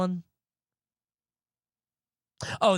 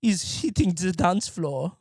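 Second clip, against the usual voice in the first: mock German techno fan; slower than usual. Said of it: "It's just".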